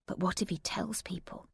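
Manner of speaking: whispered, barely voiced worry; anxious whisper. A narrator's reading. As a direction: breathe the fear out under your breath. Anxious inner thought in shower.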